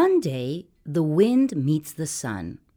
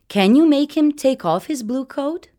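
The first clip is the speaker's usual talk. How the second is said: higher, louder playful challenge; teasing sun voice; 9 semitones higher, 4 dB louder than usual. A narrator's reading. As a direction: sun daring the wind, bright and high. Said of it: "The sun says".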